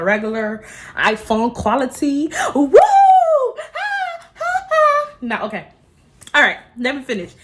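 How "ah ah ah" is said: very high, loud squeal. Real, not acted. Squealing at the viewers as the camera comes back, very high and loud. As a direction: let out a short piercing squeal.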